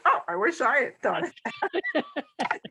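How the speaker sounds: mock amazement, ending on a laugh; sarcastic Woody Allen. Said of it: "one of those Woody Allen things".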